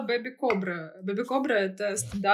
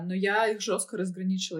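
normal talking voice

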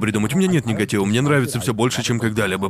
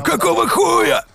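high, slow shocked shout; shocked yell; 3 dB louder, slower than usual. Retold or imitated. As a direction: yell in disbelief at the screen. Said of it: "Мы встаём и кричим".

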